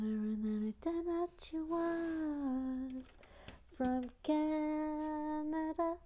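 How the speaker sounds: drawn-out reveal. Real, not acted. Punchline of the Canada story.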